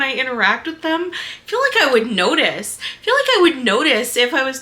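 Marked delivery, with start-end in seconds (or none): none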